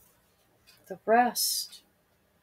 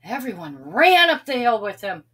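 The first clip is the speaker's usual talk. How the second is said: ordinary delivery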